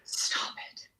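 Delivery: stunned, breath-only whisper; real hushed astonishment; whispered disbelief. Real, not acted. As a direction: whisper it as if you can't believe the coincidence. Astonished at the small-world link.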